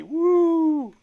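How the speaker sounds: high whooping cheer; excited cheer. Real, not acted. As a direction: whoop it up in delight. Cheering a crusty donation.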